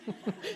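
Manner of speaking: quick cat-like hiss at her. Retold or imitated. Mother hissing at her annoying teenage daughter, cat hiss. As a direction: hiss through your teeth like a cross cat. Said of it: "Did you just hiss at me?".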